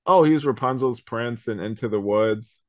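ordinary talk

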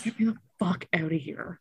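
urgent whispered plea under her breath; retelling a scared whisper to a friend; panicked whisper. Retold or imitated. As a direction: whisper it as if trapped. Retold panic inside stranger's apartment.

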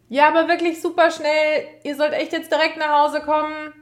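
high, loud call across the house. A narrator's reading. Parent at the door calling the kids home, loud high call.

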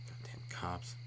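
very soft, low mutter; muttered curse. Real, not acted. Comedian to himself after cop rant, muttered curse. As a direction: curse under your breath.

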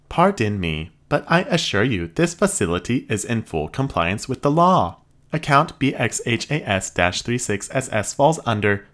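high, even robot voice; polite machine receptionist. Acted. Robot interjects.

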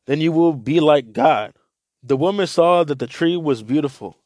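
scripture reading to listeners; Bible reading. Acted. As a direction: read the scripture out to the group. Reads Bible passage aloud.

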